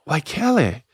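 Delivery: high-pitched mock incredulity; comic scoffing. Comic scoffing at the church's location.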